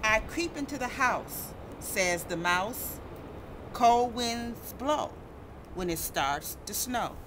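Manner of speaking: sing-song storybook read with character quotes; reading to children. Acted. Reading a snow picture book to kids, sing-song. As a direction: read it out gently to a young audience.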